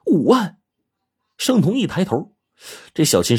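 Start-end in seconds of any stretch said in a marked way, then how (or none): 0.1-0.6 high, amazed exclamation; astonished cry. A narrator's reading. Xiaoqin discovering how much money, astonished. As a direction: blurt the sum in a much higher voice. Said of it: "一声都变了调了".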